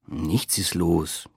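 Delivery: low, slow, subdued grumble; growled. A narrator's reading. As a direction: grumble it slowly under your breath. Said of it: "knurrte ich".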